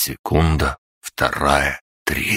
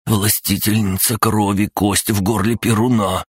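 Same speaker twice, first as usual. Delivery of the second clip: higher, reverent small voice; a small creature in awe; awed little spirit; 6 semitones higher than usual. Acted. Mokosha voiced, kneeling, praising.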